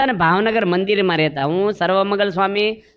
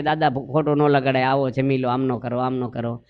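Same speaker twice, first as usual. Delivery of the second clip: gentle pleading; 6 semitones lower, 4 dB quieter than usual. Retold or imitated. Sons plead with sulking grandfather.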